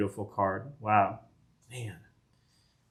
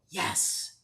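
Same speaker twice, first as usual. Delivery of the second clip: whispered yes; 9 dB quieter than usual. Real, not acted. Celebrating rare pull.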